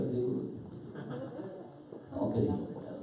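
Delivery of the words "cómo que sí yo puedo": very hushed questioning retort; barely voiced, incredulous question; Jesus's reply. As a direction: ask it almost under the breath. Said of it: "¿cómo le responde Jesús?".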